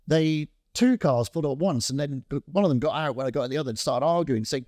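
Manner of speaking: usual speaking voice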